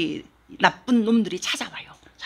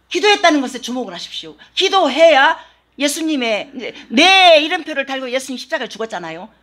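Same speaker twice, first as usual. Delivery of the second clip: rising, louder sermon, punching key phrases; full-force preaching; 5 semitones higher, 8 dB louder than usual. Real, not acted. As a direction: drive the point home to the congregation. (회중에게 힘주어 외치는 설교)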